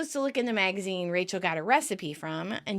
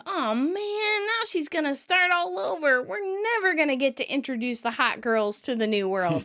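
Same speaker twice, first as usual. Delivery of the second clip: high whine easing down toward usual pitch; sad whine; 6 semitones higher than usual. Retold or imitated. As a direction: whine in mock dejection. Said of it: "Joey sadly whines".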